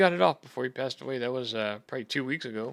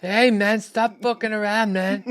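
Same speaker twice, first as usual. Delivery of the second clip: slow Australian telling-off, pitched up; Aussie accent; 8 semitones higher, 6 dB louder, slower than usual. Pretend. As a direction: scold him in a broad Aussie voice. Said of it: "can you do an, uh, Aussie accent".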